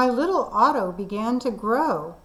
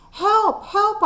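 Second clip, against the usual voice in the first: panicked cry for help; 10 semitones higher than usual. Acted. Boy on the phone begging a policeman for help, panicked high cries.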